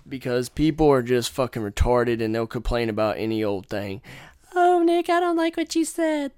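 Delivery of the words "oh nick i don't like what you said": much higher, prissy mock complainer; mocking a complainer to the viewers, in falsetto. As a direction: mince it like an offended snowflake.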